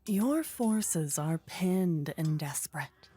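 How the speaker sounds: ordinary delivery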